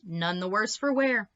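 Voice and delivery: ordinary delivery, nothing marked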